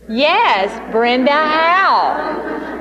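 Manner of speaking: Brenda Howe drawn out slowly, each syllable held; slow name answer. Shown as a demonstration. Director shows how to answer a recruiter, slowly naming her consultant. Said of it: "you should say".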